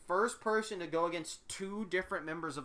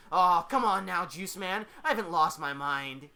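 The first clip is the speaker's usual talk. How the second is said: loud, playful protest in character; brash mock-reassurance; teasing; 8 dB louder, slower than usual. A narrator's reading. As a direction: needle him with a cocky lilt. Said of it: "he teases".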